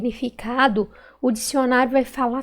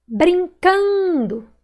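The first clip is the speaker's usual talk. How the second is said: "Brincando" very slow, high long CAN; stretched stressed syllable; 6 semitones higher, much slower than usual. Shown as a demonstration. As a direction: call out "Brincando", holding CAN. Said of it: "Qual que eu demorei mais falar".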